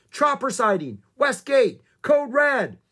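high, loud emergency bulletin; shouting back. A narrator's reading. Ranger reports a chopper over radio static, shouting urgently. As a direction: shout each alert in urgent bursts. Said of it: "the man shouted back".

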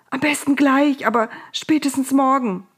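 high, urgent plea; pleading. A narrator's reading. Pharaoh begs Moses to hurry the relief, pleading. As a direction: beg insistently, pitched high. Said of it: "bat der Pharao".

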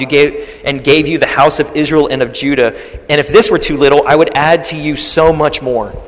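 loud, ringing prophetic proclamation; preaching at full force. Acted. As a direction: thunder it like a prophet before the king. Prophet proclaims God's words.

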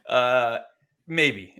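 giggling through maybe; laughing. Real, not acted. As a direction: laugh it off lightly. Laughing at co-host's hedge.

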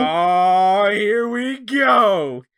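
loud, slow, drawn-out excited groan; here we go. Retold or imitated. Fan reacting as the challenge is laid down, loud slow excited drawl. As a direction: stretch it out, loud and thrilled. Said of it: "And I'm like".

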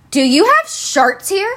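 mock her with a slow, pushy question; snotty attitude. Customer walking up to the store worker, full of attitude. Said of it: "Whole attitude and everything".

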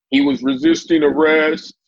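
high, loud mocking accusation; mock police narrative. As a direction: parody an officer making an official claim. Mimicking the police account of him.

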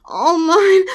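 "All mine" moaned loud and possessive; loud possessive cry. Acted. Loud possessive claim at climax.

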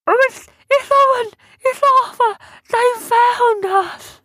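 very high, loud squeal of delight; excited little creature. A narrator's reading. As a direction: squeal it out, overjoyed. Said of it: "got all excited".

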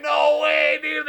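loud high disbelieving yell; shocked shout. Retold or imitated. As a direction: cry out in disbelief. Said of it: "And we're like".

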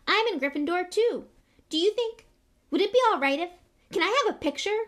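put-on very high, loud hopeful boy; Colin Creevey. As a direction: ask nervously and hopefully, voice pitched right up. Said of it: "raising the camera hopefully".

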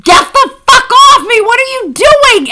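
a high retold yell, laughing through it; mock yell, laughing. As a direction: yell it as if waking in alarm, half laughing. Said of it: "And I went".

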